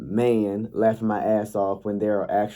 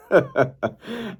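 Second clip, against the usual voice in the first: high burst of laughter; 10 semitones higher than usual. Real, not acted. Laughing at Tank's remark.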